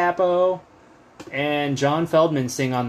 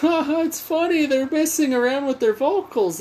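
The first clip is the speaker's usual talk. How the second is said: high squeaky voice sliding down; mock pleading kid; 12 semitones higher than usual. Kid explaining the funny vocals to parents, mock high pleading. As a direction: explain it away in a strained high pitch.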